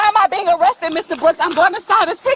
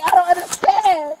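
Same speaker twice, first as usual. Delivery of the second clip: normal talking voice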